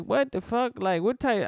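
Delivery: high, incredulous what the fuck; shocked reaction. Retold or imitated. Reacting with disbelief to the comedian's joke about his daughter. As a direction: sound floored by what you just heard. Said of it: "And I'm like".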